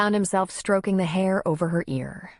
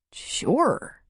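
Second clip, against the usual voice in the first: higher, rising, puzzled sure; baffled; 8 semitones higher than usual. A narrator's reading. Man answering the tearful woman, baffled. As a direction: agree as if not following.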